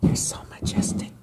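soft breathy whisper. Acted. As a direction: whisper in awe. Whispered admiration.

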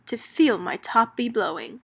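plain reading voice

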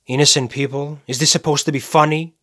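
rising, indignant question; incredulous. Acted. Killer rejects "innocent people", incredulous and indignant. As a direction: challenge it as if it were a bad joke.

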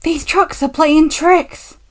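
slow, high, strained complaint; laboured, exasperated panting. A narrator's reading. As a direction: complain loudly as if out of puff. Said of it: "panted James".

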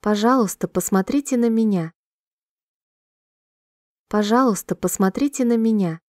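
ordinary talk, nothing marked

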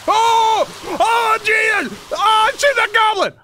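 high, loud panicked screaming; terrified scream. Real, not acted. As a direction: shriek at the jump scare. Goblin jumps out in game.

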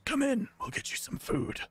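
high put-on old gentleman voice; old gnome host. Limping gnome opening the gate to hungry travelers, high put-on voice. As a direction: welcome them in with a high quavery offer. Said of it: "opens the gate and goes".

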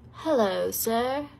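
ordinary talk, nothing marked

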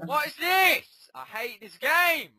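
high, drawn-out furious complaint; slow angry yelling. Real, not acted. Player raging at the game, slow high yell. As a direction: stretch every word out in rage.

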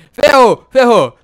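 loud dismayed ferrou; loud alarm. Pretend. Door won't open panic. (alarme alto, desesperado)

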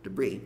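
low, falling "debris" with no final S; S mudo. Shown as a demonstration. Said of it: "na prática ele não é falado". ("debris" grave, sem som de S no fim)

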